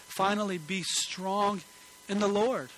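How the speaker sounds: slow, ringing scripture read; preacher reading Ephesians aloud. Acted. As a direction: proclaim the verse to the congregation. Reads scripture with emphasis.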